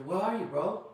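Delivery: usual speaking voice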